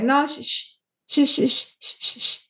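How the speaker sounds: imitated mother hushing a child; shushing mom. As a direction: cut the child off with repeated shushes. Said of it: "or the mom is like".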